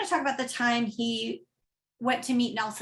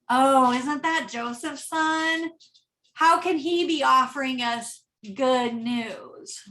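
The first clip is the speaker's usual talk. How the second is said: drip contempt on "Joseph's son"; sarcastic, patronizing; slower than usual. Shown as a demonstration. Pastor mocking the hometown crowd, sneering and sarcastic. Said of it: "turns into".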